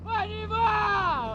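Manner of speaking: high, long-drawn cheer; hype friend cheering. Real, not acted. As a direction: stretch it out slowly, up high. Friends cheering a car through mud.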